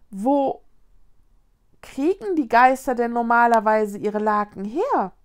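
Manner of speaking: loud, sudden outburst of a question; blurting out. A narrator's reading. Linus asks where ghosts get their sheets, blurting out. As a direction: blurt it out loudly. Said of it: "platzte Linus heraus".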